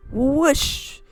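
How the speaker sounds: ordinary talk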